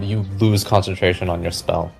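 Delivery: ordinary talk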